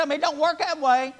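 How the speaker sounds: a homespun "It don't work that way"; folksy mock saying. Preacher mimicking a folksy line to the congregation. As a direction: say it like a plainspoken old neighbor. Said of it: "Would you look at somebody and say".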